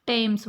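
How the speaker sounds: टेम्स with a hard T, no th sound; said Tems. Shown as a demonstration. Teacher showing students the correct way, crisp T. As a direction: say टेम्स starting with a plain T. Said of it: "थेम्स नहीं पढ़ेंगे इसको".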